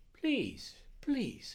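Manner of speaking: high, falling pleas; pleading Indian doctor. Acted. Doctor halting his impatient helper, high-pitched pleading. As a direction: stop them with two high, separate appeals. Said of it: "he said, turning round".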